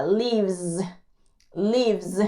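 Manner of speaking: "Lives" ending in a buzzing Z; pronounce with Z. Shown as a demonstration. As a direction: buzz the end of "lives" like a bee. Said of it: "se pronuncia como una Z".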